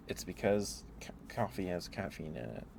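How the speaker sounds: ordinary delivery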